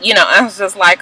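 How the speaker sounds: ordinary delivery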